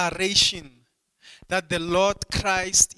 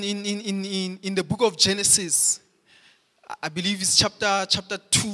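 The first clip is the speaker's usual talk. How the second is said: raised, driving pulpit delivery; fervent preaching; 3 semitones higher, 3 dB louder than usual. Acted. Pastor to congregation on exclusive truth: fervent preaching. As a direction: preach it out with mounting fire.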